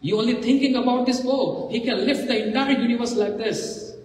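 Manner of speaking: angry outburst. Acted. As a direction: protest hotly on someone's behalf. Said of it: "Lakshman so angry, raging".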